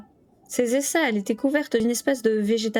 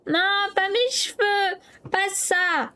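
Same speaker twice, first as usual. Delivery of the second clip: put-on high, slow child's wail; wailing protest; 10 semitones higher, slower than usual. A narrator's reading. Matt protests at his hair being washed, high child's wail. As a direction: howl like a boy being washed. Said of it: "protestait Matt en hurlant".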